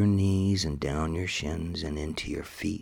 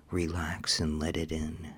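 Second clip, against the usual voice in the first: normal talking voice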